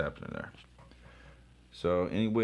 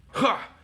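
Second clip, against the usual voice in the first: very high loud single laugh; a cocky high ha; mocking laugh; 9 dB louder than usual. Comic character laughing at his foe, high and loud. Laugh after voiced taunt.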